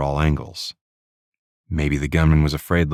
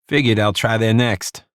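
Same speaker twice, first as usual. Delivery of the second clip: put-on New York accent; 7 semitones higher, 4 dB louder than usual. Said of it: "Di played along beautifully".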